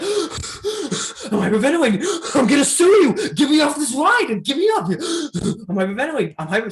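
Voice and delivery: high, shrieky panic with gasps; hammed-up hysterical mom demanding to get off; mock Karen meltdown. Imitating mom strapped into the drop ride, panicking hysterically. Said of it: "Her inner Karen just exploded".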